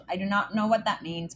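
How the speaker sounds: ordinary delivery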